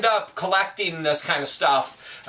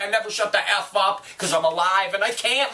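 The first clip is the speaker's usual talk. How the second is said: normal talking voice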